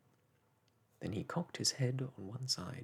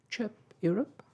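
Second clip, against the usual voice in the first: high, loud chirpy bird voice; sad little bird; 13 semitones higher, 9 dB louder than usual. A narrator's reading. Caged lark answers Mary Poppins, high and loud. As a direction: cheep it out like a dejected songbird. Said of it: "said the bird".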